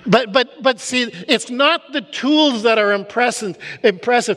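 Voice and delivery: rising preacher's fervor; preaching at full force. Acted.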